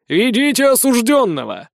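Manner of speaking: booming command. A narrator's reading. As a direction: bellow the order to the guards. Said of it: "громогласно командует".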